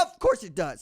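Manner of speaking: laughing sarcasm. Real, not acted. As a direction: chuckle through "of course it does". Repeats while laughing.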